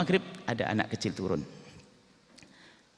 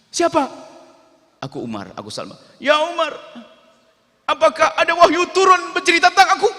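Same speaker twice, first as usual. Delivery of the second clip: high, loud panicked cries; terrified boy crying out to Umar; frightened boy; 9 dB louder than usual. Acted. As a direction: plead in terror like a startled child. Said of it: "Ketakutan dia".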